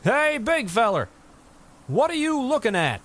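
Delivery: high, straining shout into the wind. A narrator's reading. James yelling across the peaks at the giant. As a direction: call out hard to be heard far off. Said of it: "he shouted".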